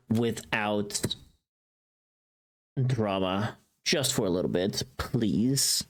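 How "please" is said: ordinary talk, nothing marked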